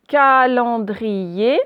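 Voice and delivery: "calendrier" loud, very slow, syllable by syllable, rising at the end; slow dictation word. Shown as a demonstration. Teacher giving pupils a word to analyse, slow syllabled dictation. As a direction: stretch "calendrier" out for careful listening. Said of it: "vous devez me dire".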